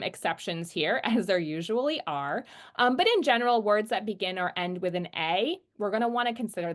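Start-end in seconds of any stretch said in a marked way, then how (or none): none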